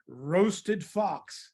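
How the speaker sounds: slow, drawn-out words; slow menacing reveal. Acted. Mouse warns the fox about the favorite food, slow reveal. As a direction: stretch out the dish name for effect.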